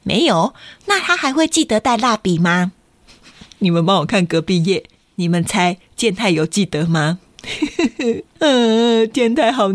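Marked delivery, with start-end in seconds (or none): none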